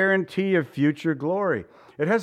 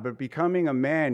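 ordinary talk, nothing marked

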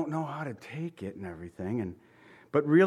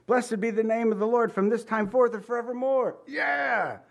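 high, excited cheering read of the verse; excited fan cheering at a touchdown; football cheer; 8 semitones higher than usual. Shown as a demonstration. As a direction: shout it like your team just scored. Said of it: "you're gonna go".

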